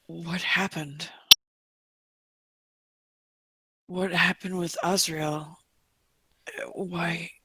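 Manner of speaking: very soft, slow questioning; hushed questions to a weak captive; hushed in-character. Acted. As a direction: ask quietly, leaving long pauses. Player gently questions the dying captive.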